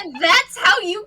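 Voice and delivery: loud, high, laughing disbelief; can't believe what they did; shocked disbelief. Acted. Kaito shocked at Umi.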